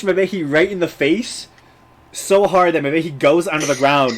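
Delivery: loud, animated scene-setting; game master narration. Acted. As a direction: project the story to the table, building to the punchline.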